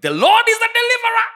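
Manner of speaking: high, fast desperate plea; crying out to be rescued; panicked cry to the Lord. Retold or imitated. Preacher retelling his panic, crying out high. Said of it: "and I was like".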